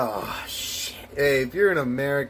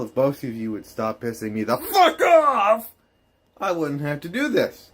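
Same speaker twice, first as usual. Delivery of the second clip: ordinary talk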